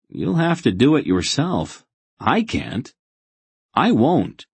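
put-on young Geryon, resolute. Boy telling the fanatic to punish himself, grave and firm. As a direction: set it out clearly, then refuse outright. Narrator plays boy refusing.

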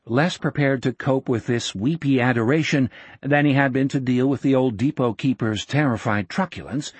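ordinary delivery, nothing marked

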